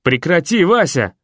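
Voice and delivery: high, loud yell of pain. A narrator's reading. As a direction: shout at the cat to stop. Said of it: "и я заорал".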